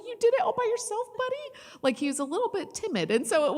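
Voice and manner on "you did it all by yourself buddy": high, fast sing-song praise; praising a toddler. Retold or imitated. Mother retelling praise to her toddler, high and fast. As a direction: cheer on a shy little boy.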